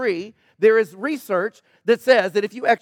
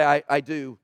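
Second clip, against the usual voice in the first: ordinary talk, nothing marked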